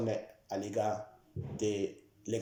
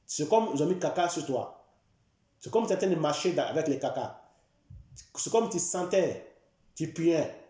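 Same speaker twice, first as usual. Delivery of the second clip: impassioned sermon, voice climbing higher; fervent preaching; 3 semitones higher than usual. Acted. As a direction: preach it with growing fervour. Preaching about the cursed man.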